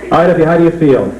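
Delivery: ordinary delivery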